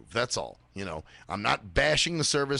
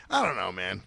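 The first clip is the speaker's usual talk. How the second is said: ordinary delivery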